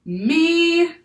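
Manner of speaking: a high, wild, shrill creature cry. Acted. Octopus speaks to the crew, wild and shrill. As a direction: cry it out like something from a forest. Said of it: "wild and shrill, as if from a deep forest".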